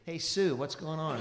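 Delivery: loud mock greeting, laughing through it; teasing friend. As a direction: call out the nickname loudly, ribbing him. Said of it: "Stephen Holland to this day says".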